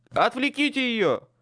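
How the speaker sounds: loud, high shouted order. A narrator's reading. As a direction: yell the command. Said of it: "крикнул я".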